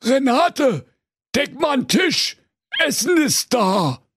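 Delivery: shouting ogre husband, lightly voiced; fearsome ogre. A narrator's reading. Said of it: "eine furchterregende Stimme rief".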